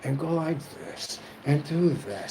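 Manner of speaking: quiet mock spirit instructions; gatekeeper's voice. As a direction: show the spirits giving directions quietly. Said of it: "they be like".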